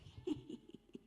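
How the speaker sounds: silent airy snicker to herself; silent laugh. Retold or imitated. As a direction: laugh without voice. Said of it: "she laughed silently to herself".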